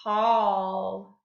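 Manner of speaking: "Hall" low and loud with held aw; vowel switch demo. Shown as a demonstration. Teacher has class repeat the word, low stretched "Hall". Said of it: "Say it".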